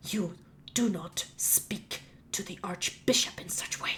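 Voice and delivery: slow, hushed, whispery rebuke; quietly seething gnome; offended gnome bishop. Acted. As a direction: reprimand slowly under your breath, word by word. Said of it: "the gnome comes up and just".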